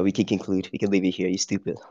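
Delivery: ordinary delivery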